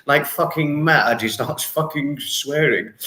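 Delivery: ordinary talk, nothing marked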